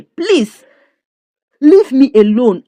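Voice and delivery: fed-up wife snapping at the woman pushing her home; exasperated plea. Retold or imitated. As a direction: push them away, fed up. Clara refusing to go back.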